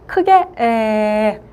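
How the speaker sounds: 에 said slowly with the mouth open wide; wide-mouthed vowel. Shown as a demonstration. Teacher shows the open 에 vowel, slow. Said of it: "입 모양을 아주 조금 크게 벌려주시고".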